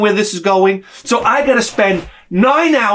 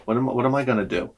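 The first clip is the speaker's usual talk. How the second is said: resigned mutter; 6 semitones lower, 9 dB quieter, faster than usual. Retold or imitated. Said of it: "I'm like".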